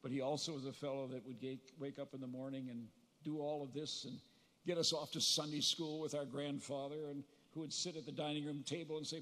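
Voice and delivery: usual speaking voice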